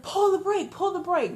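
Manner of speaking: high, urgent shout to pull the brake; panicked yell from the back seat. Retold or imitated. Friend in back seat yelling at the driver, panicked shout. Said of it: "he's like".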